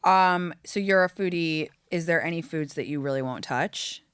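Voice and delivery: normal talking voice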